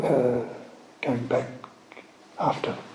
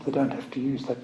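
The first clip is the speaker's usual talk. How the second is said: ordinary talk, nothing marked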